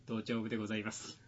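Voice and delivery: quiet formal funeral attendant voice; imitating a hushed, ceremonious attendant; hushed solemn attendant. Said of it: "頭頂部でございますっていう".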